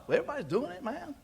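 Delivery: soft, high-pitched voice of a follower; mock excuse-making. Worker justifying himself on the job, soft mock excuse. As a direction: lightly mimic someone shrugging off blame.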